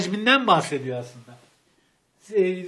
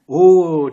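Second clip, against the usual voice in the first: impressed oh; 3 semitones lower, 11 dB louder than usual. Real, not acted. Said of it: "çok karizmatik oldu ama".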